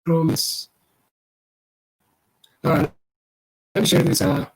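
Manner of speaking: crackly, breaking-up audio; scratchy mic. Real, not acted. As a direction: voice through a crackling line. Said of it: "you're kinda scratchy".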